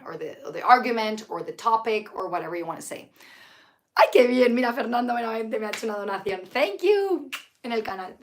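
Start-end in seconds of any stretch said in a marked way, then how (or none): 4.0-4.5 a sudden bright burst, louder; pleased, loud ay; delighted exclamation. Real, not acted. Teacher reacting to a viewer's donation, delighted and loud. 6.5-7.3 a high, lifted thank you; grateful thanks. Real, not acted. Teacher thanking Fernando for the donation, high and grateful.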